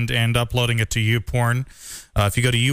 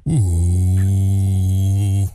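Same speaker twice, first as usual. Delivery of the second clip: louder, bass-heavy ooh; bass boosted; 5 semitones lower, 5 dB louder than usual. Shown as a demonstration. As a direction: ooh with boosted low end. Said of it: "let me boost the low frequency response".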